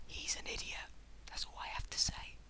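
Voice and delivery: dismissive whisper; whispered parting shot at the commenter. Real, not acted. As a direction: breathe it as a sly aside. Final aside about the commenter.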